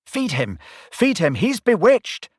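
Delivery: building from urging to a high shout; cheering the winger on, rising; excited cheering. A narrator's reading. Narrator urging his team from the stand, building excitement. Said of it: "I found myself saying".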